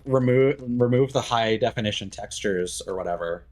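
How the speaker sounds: ordinary talk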